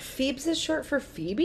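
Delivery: high, slow, bewildered question; imitating Phoebe. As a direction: ask it high in mock astonishment. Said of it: "She was so confused".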